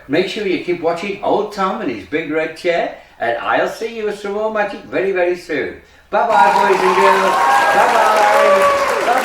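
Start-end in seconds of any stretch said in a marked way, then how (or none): 6.1-9.2 big sing-out farewell; calling goodbye. Real, not acted. Host calls goodbye to children.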